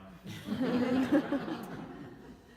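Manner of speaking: high, loud, falling laugh; bright laugh. Real, not acted. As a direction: burst out laughing at yourself. Laughs at own refusal.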